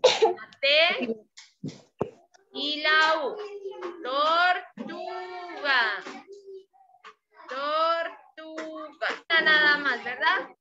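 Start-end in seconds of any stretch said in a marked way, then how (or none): 4.0-5.8 very slow "Tortuga", each syllable stretched apart; full word "Tortuga" sounded out slowly. Shown as a demonstration. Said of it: "la sílaba tu". 7.5-9.1 very slow, drawn-out "Tortuga" with a long u; stretched word. Shown as a demonstration. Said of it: "la sílaba tu".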